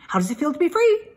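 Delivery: high, quick goose voice repeating syllables; stammering goose. A narrator's reading. Goose coaxes Wilbur to come out, high and quick. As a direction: cluck the words out fast and high.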